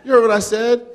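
high, loud mock outrage; mock indignant. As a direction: pretend to be offended. Teasing host about the word huh.

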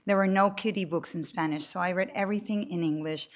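ordinary talk

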